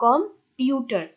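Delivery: Computer split, with পিউ punched; stress on second syllable. Shown as a demonstration. Teacher repeating the stress example, punched পিউ in computer. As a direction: hit পিউ after a light কম in computer. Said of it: "পি রূপকে একটু জোর দিয়ে বলা হয়".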